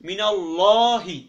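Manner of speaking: loud, slow "মিনাল্লাহি" with a round "llaw"; rounded mouth, heavy lam. Shown as a demonstration. Teacher showing how to round the mouth, loud heavy "ল্লা" in মিনাল্লাহি. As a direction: belt out "ল্লা" in মিনাল্লাহি, thick and held long. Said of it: "মুখটা গোলাকার করে পড়তে হবে".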